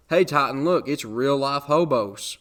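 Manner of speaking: retold kid's loud, drawn-out announcement; excited call-out. Acted kid calling out to friend.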